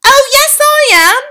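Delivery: put-on boy turned mouse popping out of the handbag; cheeky mouse-boy. A narrator's reading. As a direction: chirp it cheekily. Bruno the mouse boy speaks.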